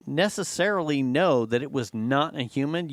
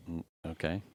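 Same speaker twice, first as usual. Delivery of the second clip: low, very soft, wary acknowledgement; muted, doubtful reply; underwhelmed; 9 semitones lower, 10 dB quieter, faster than usual. Acted. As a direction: quietly humour an overexcited machine. Underwhelmed reply to overexcited assistant.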